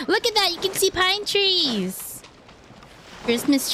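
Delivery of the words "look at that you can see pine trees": excited. Real, not acted. Spotting pine trees while exploring.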